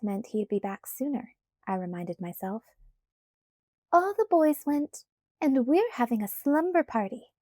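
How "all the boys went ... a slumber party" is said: bright high sing-song announcement; trilling sing-song. A narrator's reading. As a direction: lilt it up like a cheerful pixie. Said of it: "in her trilling sing-song voice".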